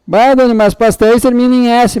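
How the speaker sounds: a light, hammed-up student objection; student objecting. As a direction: play the puzzled student catching her out. Teacher voices objecting student.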